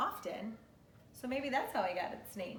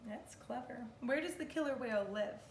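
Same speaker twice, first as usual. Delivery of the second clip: ordinary talk, nothing marked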